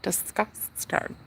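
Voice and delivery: slow, soft unvoiced sneer; slow disgusted whisper at the jealous ex. Real, not acted. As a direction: breathe out contempt slowly.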